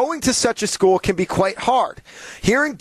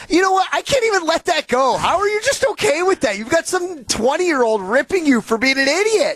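exasperated; 9 semitones higher than usual. Real, not acted. Host fired up at cohost.

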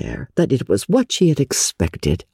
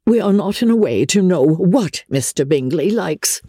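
high-pitched resentful mother; 7 semitones higher than usual. A narrator's reading. Mother on the visit they won't make, resentful. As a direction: say it with pointed resentment. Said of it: "said her mother resentfully".

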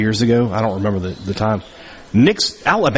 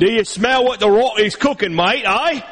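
high-pitched English accent veering Australian; bad English accent; 8 semitones higher than usual. Shown as a demonstration. Co-host doing The Rock's catchphrase on request, mock English accent. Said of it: "with an English accent".

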